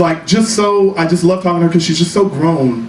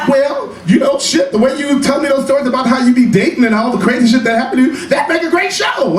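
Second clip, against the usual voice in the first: excited friend; 7 semitones higher than usual. Retold or imitated. Friend Angela urging him excitedly.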